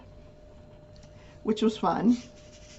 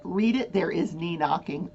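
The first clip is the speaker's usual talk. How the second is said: ordinary talk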